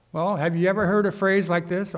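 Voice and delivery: ordinary talk, nothing marked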